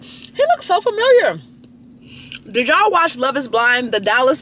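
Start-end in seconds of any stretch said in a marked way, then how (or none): 0.0-1.4 high squeal. Retold or imitated. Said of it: "I was like".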